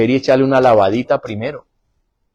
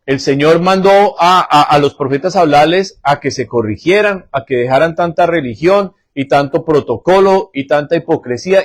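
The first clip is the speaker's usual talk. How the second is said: preaching; 5 semitones higher, 5 dB louder than usual. Acted. Preacher building the sermon.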